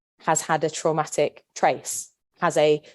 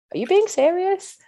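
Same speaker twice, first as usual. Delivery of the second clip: high, louder disbelieving squeak; incredulous retort; 4 dB louder than usual. Retold or imitated. To a housemate after her remark, incredulous high retort. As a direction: throw it back at her in open disbelief. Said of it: "look at her and go".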